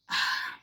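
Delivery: long breathy sigh; exasperated sigh. Real, not acted. Vlogger sighing after her mandazi story, exasperated sigh. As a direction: let out one big sigh. Sighs after retelling the outburst.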